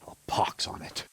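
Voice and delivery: a bitter curse under the breath, barely voiced; muttered curse. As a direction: swear to yourself so nobody hears. Captain curses under his breath.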